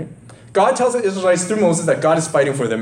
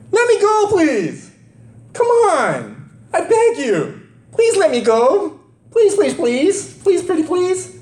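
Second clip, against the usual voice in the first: high, drawn-out begging whine; pleading please please please, high and slow; 12 semitones higher, slower than usual. Shown as a demonstration. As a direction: beg like a child who wants to go. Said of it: "That's how it's supposed to be read".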